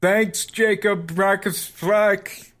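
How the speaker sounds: mocking crowd call; heckler yelling back. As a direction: yell it back across the bar, drawn out. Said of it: "is just going to yell back".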